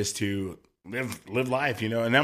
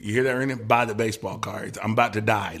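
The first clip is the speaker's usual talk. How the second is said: ordinary delivery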